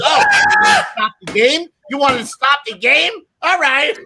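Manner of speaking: high, loud taunting shout; mock angry yell. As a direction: shout it like a drunk daring you. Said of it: "And he goes".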